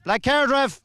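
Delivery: high shout at the ref; yelling from the sidelines. Real, not acted. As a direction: yell it across the pitch. Calling out to the referee.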